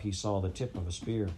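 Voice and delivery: plain reading voice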